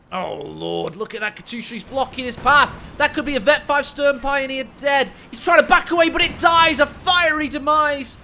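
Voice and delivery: high, loud shouted play-by-play; excited game caster. Real, not acted. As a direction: shout the kill as it happens. Live call of a kill.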